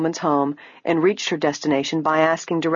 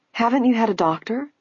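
higher, astonished question; 6 semitones higher than usual. A narrator's reading. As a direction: ask in shock that nobody came. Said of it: "asked in astonishment".

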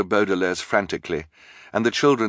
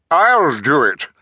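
loud, raised-voice claim; crying out; 7 semitones higher, 7 dB louder, slower than usual. A narrator's reading. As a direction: shout to get in first. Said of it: "the bald man cried".

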